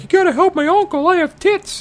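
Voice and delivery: very high falsetto plea; hammed-up high falsetto begging; pleading Leia. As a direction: beg in a squeaky girl voice. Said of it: "Leia's like".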